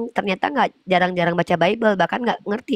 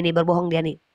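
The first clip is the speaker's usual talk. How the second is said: ordinary talk, nothing marked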